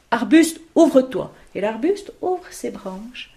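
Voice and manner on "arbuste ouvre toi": magic command. Acted. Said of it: "Tantari dit à l'arbuste".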